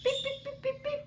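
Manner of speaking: very high squeaky beeps; imitated beeping. Shown as a demonstration. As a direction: coax him with little bird beeps. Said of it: "You wanna say hi?".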